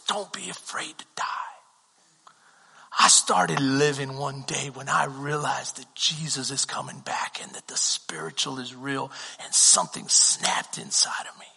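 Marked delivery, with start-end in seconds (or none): 0.1-1.3 soft unvoiced whisper; whispered counsel. Mentor whispering to Chris not to fear death. 6.5-11.5 whispered, intense; hushed testimony. Retold or imitated. Mentor whispering how something snapped inside him.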